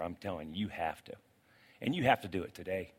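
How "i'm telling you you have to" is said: low earnest plea. Real, not acted.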